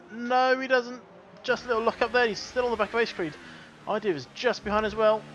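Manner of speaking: rising excitement, quick play-by-play; motorsport commentator. Acted. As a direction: call the overtake attempt, building up. Live race play-by-play.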